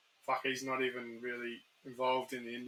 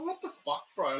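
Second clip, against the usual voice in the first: shocked what the fuck; 10 semitones higher than usual. Retold or imitated. Mate baffled by a friend who turns monetization off, shocked. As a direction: blurt it out in total disbelief. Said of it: "I'm like".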